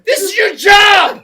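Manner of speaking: loud, slow, angry shout; mock shout. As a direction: bark it at the guide, slowly.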